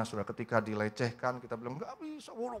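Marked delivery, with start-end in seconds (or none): 1.8-2.6 acted indignant outburst, pitched way up; mock outrage. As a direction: squeal an angry refusal, half-voiced. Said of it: "marah sekali".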